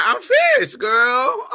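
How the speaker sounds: shrieked high and loud with a laugh; campy sassy squeal. Pretend. To the audience, a laughing high-pitched camp squeal.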